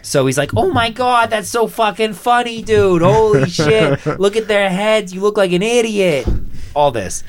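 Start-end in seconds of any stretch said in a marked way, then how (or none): 0.6-6.3 hammed-up Boston dude accent; Adam Sandler Boston accent. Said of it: "this Massachusetts Boston accent".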